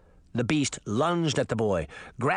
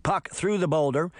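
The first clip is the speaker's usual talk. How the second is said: ordinary delivery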